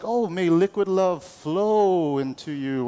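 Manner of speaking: slow, drawn-out mock blessing. Pastor quoting a funny church phrase, slow mock blessing. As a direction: stretch it out like a flowery prayer. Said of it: "I never heard it say, like".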